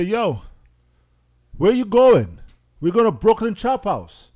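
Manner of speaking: retelling the cabbie argument, heated and loud. Retold or imitated. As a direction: hammer the address at the cabbie, getting worked up. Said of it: "I started wilding out".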